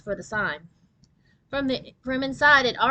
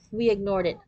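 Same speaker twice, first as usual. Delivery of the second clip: usual speaking voice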